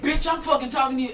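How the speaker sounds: high, fast, forceful snap; retold angry comeback. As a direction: act out cutting someone off in a fight. Retold shouting match.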